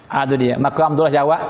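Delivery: ordinary talk, nothing marked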